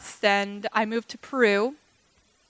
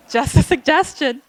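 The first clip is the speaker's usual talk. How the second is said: laughing; 4 semitones higher, 8 dB louder than usual. Retold or imitated. Laughing at her own joke.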